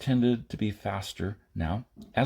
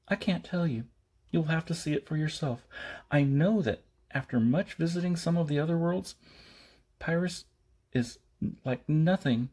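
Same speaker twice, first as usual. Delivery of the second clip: put-on higher, earnest young woman's voice; Maida character voice; 7 semitones higher than usual. A narrator's reading. Maida warning Jason about Pyrrhus, higher and earnest. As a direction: plead with him in her higher voice.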